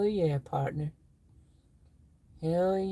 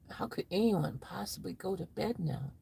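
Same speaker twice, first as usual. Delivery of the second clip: whispered comment read; hushed whisper; 8 dB quieter than usual. Pretend.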